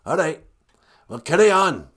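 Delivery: hearty pirate send-off, high and loud; Gitche Manadu. Acted. Creator sending his son off to pray, gruff pirate voice. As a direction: wave him on in a gruff pirate voice. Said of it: "kinda sounds like a pirate".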